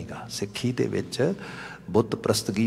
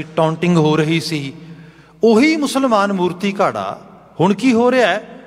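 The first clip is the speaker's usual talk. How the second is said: high-pitched emphatic sermon delivery; impassioned exposition of scripture; fervent preaching; 9 semitones higher, 6 dB louder than usual. Real, not acted. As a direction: drive the teaching home, pitched up.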